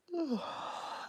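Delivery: long high yawning sigh; big yawn. Real, not acted. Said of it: "Excuse me".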